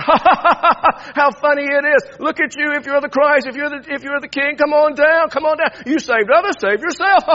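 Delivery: loud, high jeering crowd with forced ha-ha's; mocking laughter. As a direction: taunt and laugh like a mocking mob. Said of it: "They're all mocking and laughing and carrying on".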